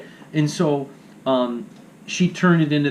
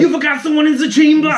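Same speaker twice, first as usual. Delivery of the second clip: higher, louder hammed-up German accent; mock German accent; 11 semitones higher, 6 dB louder than usual. Joking as Hitler, loud mock German accent. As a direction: bark it like a cartoon German officer. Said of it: "terrible German Hitler accent".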